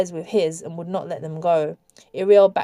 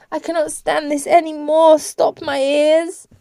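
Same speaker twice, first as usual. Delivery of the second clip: high, distressed begging; raised, anguished voice; desperate plea; 8 semitones higher, 4 dB louder than usual. A narrator's reading. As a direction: beg for relief, dragging the last words. Girl begs to stop hearing sobs.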